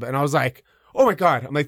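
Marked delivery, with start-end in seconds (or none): 0.9-1.4 loud, high-pitched burst of amazement; thrilled. Retold or imitated. Watching the tough kid throw the bully, a loud high gasp of joy. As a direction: blurt it out, overjoyed and stunned.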